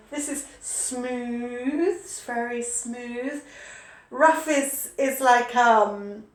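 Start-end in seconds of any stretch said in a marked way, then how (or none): 0.1-3.4 slow, soft, savouring tone; hushed and slow, relishing the softness; soft and dreamy. Shown as a demonstration. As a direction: linger on each word like stroking velvet. Stroking a velvet cushion.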